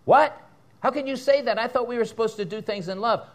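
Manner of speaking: hammed-up offended congregant; objecting churchgoer. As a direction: mimic a scandalised listener.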